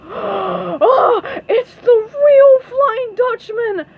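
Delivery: high, loud frightened scream; Squidward screaming. A narrator's reading. Squidward sees the real Flying Dutchman, high terrified scream. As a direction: shriek it in alarm. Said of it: "screamed Squidward".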